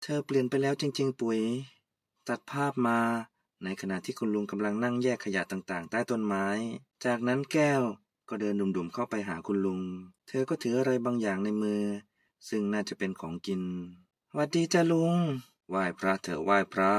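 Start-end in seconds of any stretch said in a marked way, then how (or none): 2.3-13.6 low, soft narrator voice setting the scene; story narration. A narrator's reading. Narrator describing the three friends, lower and softer. 15.7-16.9 put-on much lower, easygoing old man greeting; old uncle. Old uncle greeting Kaew, much lower.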